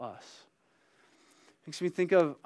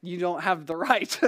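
raised, louder mock-indignant "Sir"; indignant inner protest; 3 semitones higher, 6 dB louder than usual. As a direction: let the outrage build, then crack into a laugh. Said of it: "is what my heart was saying".

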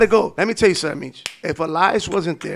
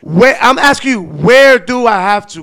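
louder, slow insistent question; exasperated demand; 3 semitones higher, 9 dB louder than usual. Retold or imitated. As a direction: demand an answer slowly. Retelling demanding directions.

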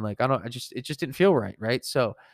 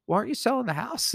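much higher, mock-puzzled question; puzzled challenge; 11 semitones higher, faster than usual. Salesman questioning the customer's paint choice, puzzled. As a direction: retell it as a high-pitched, baffled objection.

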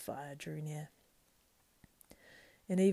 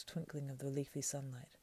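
usual speaking voice

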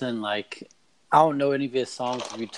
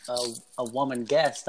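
ordinary talk, nothing marked

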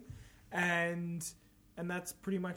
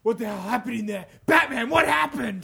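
lightly voiced commissioner, louder at the end; Commissioner Gordon; 3 semitones higher, 5 dB louder than usual. Shown as a demonstration. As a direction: redo it as an urgent police chief. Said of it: "So that should have been like".

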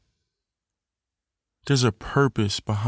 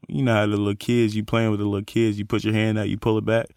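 ordinary talk

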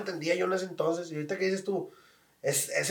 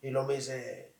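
ordinary talk

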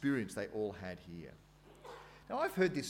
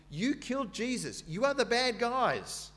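"You killed Jesus" hit hard on "You", higher and louder; accusing emphasis; 11 semitones higher, 6 dB louder than usual. Retold or imitated. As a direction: point the finger at the crowd. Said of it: "He basically says".

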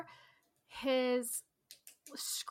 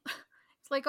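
ordinary talk

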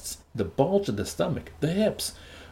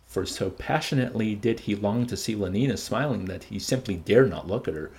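usual speaking voice

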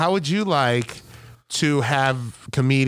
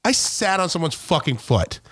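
ordinary delivery

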